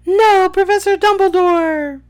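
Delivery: high, loud desperate protest; shout. A narrator's reading. Harry crying out to Dumbledore, high loud shout. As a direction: shout to stop the professor. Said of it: "shouted Harry".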